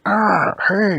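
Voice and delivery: loud, high mock yelp; startled protest. Donkey Kong grabbed from behind, startled mock protest.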